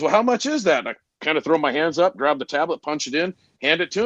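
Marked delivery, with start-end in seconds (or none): 0.0-0.8 customer asking. Retold or imitated. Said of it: "And he goes".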